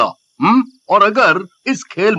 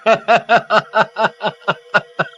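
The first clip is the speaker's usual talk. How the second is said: staccato triumphant cackle; hammed-up gloating laughter; mocking laugh; 2 semitones higher than usual. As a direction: laugh at her failure in separate bursts. Mocks the failed summoning.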